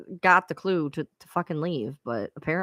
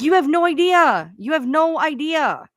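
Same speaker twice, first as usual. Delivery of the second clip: exasperated emphasis; 8 semitones higher, 4 dB louder than usual. Real, not acted. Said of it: "venting exasperation".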